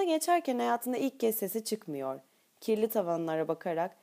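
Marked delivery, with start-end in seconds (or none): none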